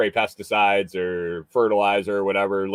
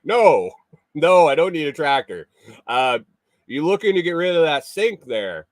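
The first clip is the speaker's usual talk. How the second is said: quoting himself; 6 semitones higher, 4 dB louder than usual. Retold or imitated.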